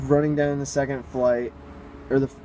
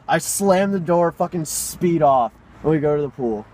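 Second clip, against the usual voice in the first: usual speaking voice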